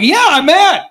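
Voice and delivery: high-pitched, indignant mock outburst; retold anger. Group leader answering the pastor's remark, hammed-up mad. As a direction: own up to it hotly, playing it for laughs. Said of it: "You saw you're getting mad".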